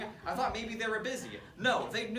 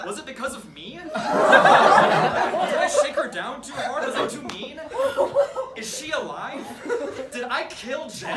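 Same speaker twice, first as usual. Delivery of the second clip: mock panic, higher and louder; freaking out; 7 semitones higher, 12 dB louder, slower than usual. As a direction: spiral into comic guilty panic. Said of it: "I freak out a little bit".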